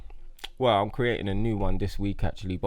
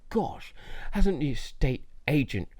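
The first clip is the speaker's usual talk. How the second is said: posh voice; 4 semitones higher than usual. Retold or imitated. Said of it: "I used to do a posh voice".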